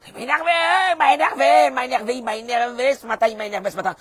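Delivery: high, loud acted tantrum, easing down; losing it. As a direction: rant it out high, on repeat. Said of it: "faire péter des câbles". (pétage de plombs joué, aigu et fort)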